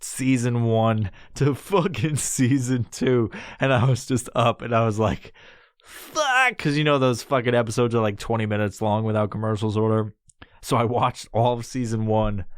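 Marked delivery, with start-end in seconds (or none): 5.8-6.6 high, drawn-out exclamation; dismayed cursing. Retold or imitated. Man still up watching episodes, dismayed. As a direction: curse it high in mock dismay.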